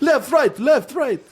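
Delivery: very high, loud mock rant; mimicked angry driver. Driver's tirade as passengers heard it, high-pitched mockery. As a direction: parody his ranting in a high, loud voice. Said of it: "it just sounded like".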